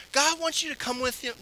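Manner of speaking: ordinary talk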